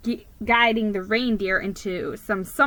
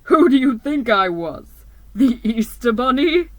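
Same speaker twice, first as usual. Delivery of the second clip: booming Santa; 7 dB louder than usual. A narrator's reading. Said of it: "in a deep, booming voice".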